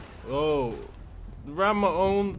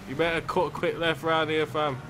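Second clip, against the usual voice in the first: normal talking voice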